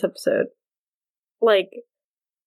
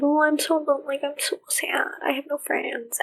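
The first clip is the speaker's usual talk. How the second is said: breathy fake self-pity, higher; mock pitiful whisper; 5 semitones higher, 6 dB quieter than usual. Podcaster mocking Kavanaugh luring Elizabeth, mock sad whisper. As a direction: whisper it like a sulky victim angling for sympathy. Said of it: "luring her and being like".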